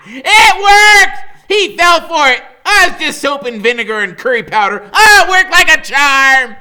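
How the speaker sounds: high, loud giddy shout through laughter; manic triumphant shouting. Acted. Freak celebrating that the trick worked, high manic laughing shout. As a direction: crow with wild glee. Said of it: "Freak is laughing like maniac".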